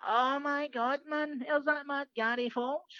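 high, drawn-out addict voice; slow, high-pitched starstruck drawl; wee addict. Retold or imitated. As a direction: imitate an amazed addict spotting a comedian. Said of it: "there's a wee addict serving the food".

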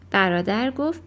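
usual speaking voice

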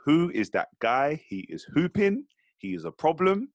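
high, emphatic awe, each phrase rising; stretched-out, rising disbelief in admiration; impressed self-talk. Retold or imitated. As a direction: marvel at a rival player. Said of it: "I said to myself".

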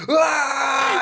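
shirtless-pitchman holler, hammed up; imitated yell. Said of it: "just a guy yelling".

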